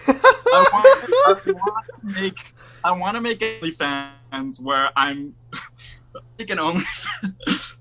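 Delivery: stretched out slowly through laughter; barely gets the words out laughing. Real, not acted. Imagining his vomit OnlyFans, laughing.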